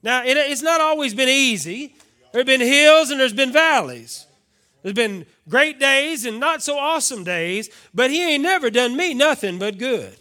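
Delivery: loud, high sermon climax; full-force preaching. Acted. Pastor testifying to the congregation, loud and soaring. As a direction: lift the church with every line.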